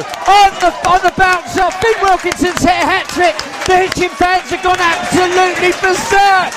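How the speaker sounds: high, loud and thrilled; excited goal call. Real, not acted. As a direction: keep roaring the hat-trick news. Goal call continues, still shouting.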